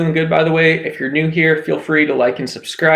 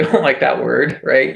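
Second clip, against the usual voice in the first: ordinary talk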